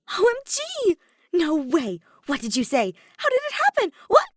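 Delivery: shrieking high and loud, questions tumbling out; best friend squealing over the big news; excited fangirl squeal. Acted. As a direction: squeal with delight, firing questions. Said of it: "like she does when she gets excited".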